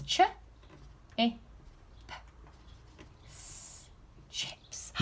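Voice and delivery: Ch i p s, then chips whispered; sounding out. Shown as a demonstration. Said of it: "There's today's learning".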